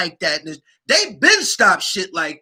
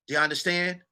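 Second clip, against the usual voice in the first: the question dropped to a softer aside; softer, half to himself; softer repeat; 8 dB quieter, faster than usual. Real, not acted. Asking viewers again, softer.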